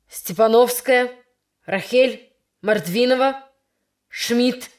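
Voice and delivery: громко и сердито выкликает фамилии; вызывай девочек с досадой. Acted. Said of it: "сердито вызывал девочек Яковлев". (сердито вызывает по списку)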